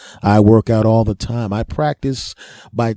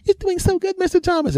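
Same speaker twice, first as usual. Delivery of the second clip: very high sweet female voice; high, encouraging nurse voice; cheering women. Retold or imitated.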